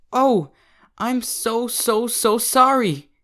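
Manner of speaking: high, slow, drawn-out apology; flustered filly. Acted. Derpy apologizing to the old mare, high and flustered. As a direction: stretch out the sorry, mortified.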